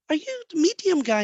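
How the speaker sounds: high-pitched retold disbelief; surprised grandmother. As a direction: ask it in mock astonishment. Retold grandmother recognises the medium.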